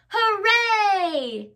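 high cheerful cheer; shout. A narrator's reading. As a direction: cheer it out joyfully. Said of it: "shouted Little Beaver".

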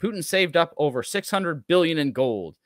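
ordinary talk

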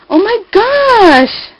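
high, slow, swooping delight; real overjoyed exclamation; thrilled. Real, not acted. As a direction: gush with a long, high stretched ending. Delighted at flowers in gift.

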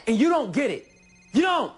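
shouted high and loud, building on "don't"; exasperated, full-voiced outburst; angry shout. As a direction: yell it in frustration, hammering "don't". Quarrel peaks.

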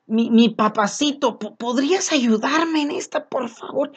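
put-on a lightly childlike loving prayer voice; sweet tender plea. Shown as a demonstration. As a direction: coo it tenderly, asking nicely. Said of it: "mira el tono de voz".

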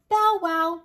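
loud high barking bow wow; hammed-up loud woof; dog bark. Animals scare off the robbers.

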